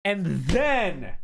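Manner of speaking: "then" swooping up high and loud; dramatic build-up. Acted. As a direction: stretch "And then" with a big rise and fall on "then". Dramatic build-up to next event.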